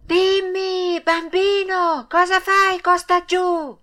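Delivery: high, drawn-out call from far overhead; long, high-pitched hailing cry; shouted from above. A narrator's reading. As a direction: call down to a child on the ground. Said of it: "gli gridò da una grande altezza".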